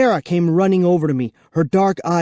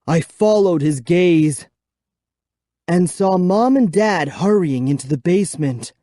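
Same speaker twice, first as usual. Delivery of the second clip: ordinary delivery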